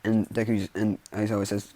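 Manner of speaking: usual speaking voice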